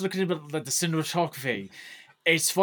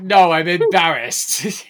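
slow whining embarrassed plea; mock embarrassed whine; 11 dB louder, slower than usual. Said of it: "And she's like".

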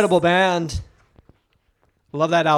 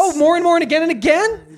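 much higher, louder indignation; mock outrage; 10 semitones higher, 5 dB louder than usual. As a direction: squeal it in disbelief. Mock outrage at repeat tours.

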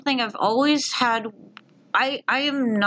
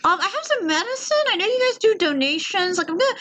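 very high forced-cheerful phone voice; squeaky chipper tone. Retold or imitated. Calling the vet about dead cat, very high chipper tone. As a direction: squeak it out brightly. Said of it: "That's the actual tone you used on that phone call?".

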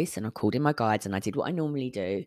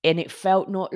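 ordinary talk

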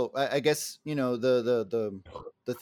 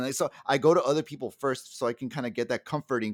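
ordinary delivery

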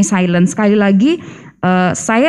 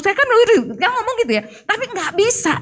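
exasperated; 10 semitones higher than usual. Real, not acted. Wife admitting she couldn't speak, high and exasperated. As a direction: pitch jumps way up, voice softens. Frustrated confession.

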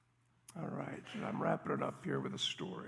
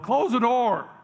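loud, high shout across a diner; yelled; 7 semitones higher, 14 dB louder than usual. Retold or imitated. Stranger in the diner yelling at whoever opened the door, loud high shout. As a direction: bark it over the cold wind, full volume. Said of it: "somebody yelled".

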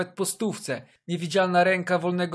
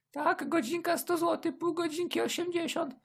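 high-pitched escort voice; sweet young woman answering; 13 semitones higher, 4 dB quieter than usual. Acted.

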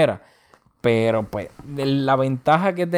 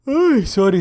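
yawning; 8 semitones higher, 4 dB louder, slower than usual. Real, not acted. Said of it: "estoy bostezando".

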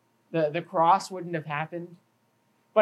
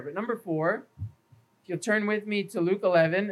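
usual speaking voice

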